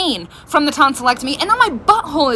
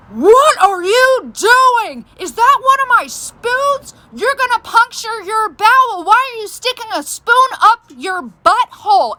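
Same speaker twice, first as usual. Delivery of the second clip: mom yelling; 8 semitones higher, slower than usual. Retold or imitated. Mother bursting into the bathroom, high shocked scolding. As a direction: play the horrified mother catching her kid. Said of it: "my mom is screaming at me".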